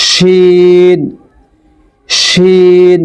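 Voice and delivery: শিন with the i held long; slow, steady শিন; long drawn-out shin. Shown as a demonstration. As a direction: sustain শিন for four counts. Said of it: "চার আলিফ পরিমাণ টেনে পড়তে হবে".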